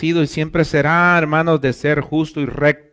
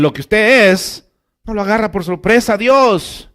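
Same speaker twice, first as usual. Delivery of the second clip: loud, higher preaching, slowing to hammer home omnisciente; full-force preaching; 5 semitones higher, 7 dB louder than usual. Acted. Pastor insisting to the congregation that God knows them, loud preaching. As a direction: proclaim it to the whole church.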